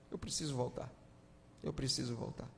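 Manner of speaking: a hushed vow to go home; quiet resolve. Acted. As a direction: say it to himself, hushed. Prodigal son resolving to return.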